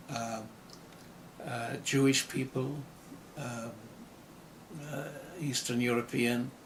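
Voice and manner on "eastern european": ordinary talk, nothing marked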